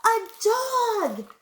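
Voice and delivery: high, loud shocked exclamation; gasped. A narrator's reading. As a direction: cry out in horrified surprise. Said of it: "gasped the manager".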